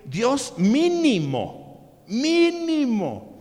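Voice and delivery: "mínimo" stretched very slow, second one rising high; drawn out mínimo. Acted. Preacher stressing that God gave at least one talent, "mínimo" drawn slowly. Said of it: "preacher stretches word mínimo".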